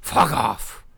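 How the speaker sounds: loud, gruff patriarch impression; Logan Roy. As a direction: hammer it out like a TV tyrant. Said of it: "my Logan Roy impression".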